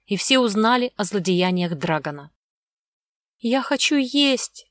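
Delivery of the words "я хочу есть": put-on high, drawn-out childish whimper; high plaintive child's whine; whimpering girl. A narrator's reading. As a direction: whine it slowly like a hungry little girl. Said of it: "прохныкала Огнева".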